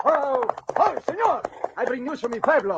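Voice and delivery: high, loud eager calling out; breathless excited arrival. Acted. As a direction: burst in with urgent news. Rider arrives bringing news.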